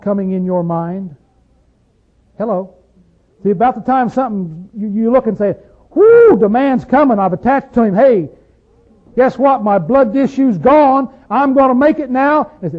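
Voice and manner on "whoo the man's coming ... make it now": excited, higher and louder voice of the healed woman; woman cured of bleeding cries out in excitement; joyful woman. Retold or imitated. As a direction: burst out with relief and joy.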